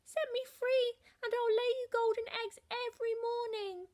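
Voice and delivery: high pleading hen voice. A narrator's reading.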